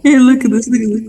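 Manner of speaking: loud gleeful brag; excited gloating. Real, not acted. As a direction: show off the win with glee. Gloating after big win.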